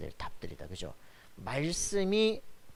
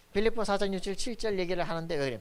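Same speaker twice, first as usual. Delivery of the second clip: ordinary talk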